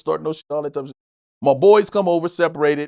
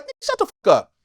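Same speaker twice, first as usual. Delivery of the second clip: irritated snap; 8 semitones higher than usual. Retold or imitated. Comic snapping at [name] to shut up, high angry snap. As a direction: cut him off hard. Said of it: "I'm like".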